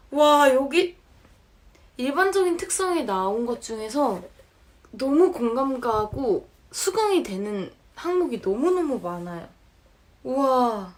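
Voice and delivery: loud, thrilled amazement; excited. Real, not acted. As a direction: gush about how well it fits. Excited about matching results.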